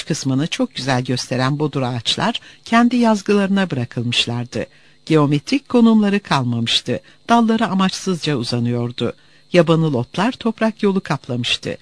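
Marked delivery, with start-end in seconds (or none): none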